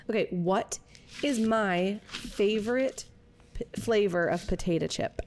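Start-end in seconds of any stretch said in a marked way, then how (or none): none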